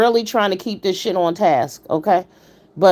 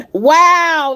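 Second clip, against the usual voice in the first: stunned wow; 9 semitones higher, 6 dB louder than usual. Real, not acted. Stunned reaction to the vulgarity.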